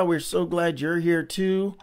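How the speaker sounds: usual speaking voice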